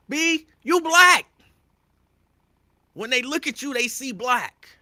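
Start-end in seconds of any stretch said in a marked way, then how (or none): 0.1-1.3 high, loud, slow shout; shaking her shout. Pretend. Imagined shaking of Madison Keys, high loud slow shout. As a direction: shake her into hearing it. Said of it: "I wanna grab her and shake her and say".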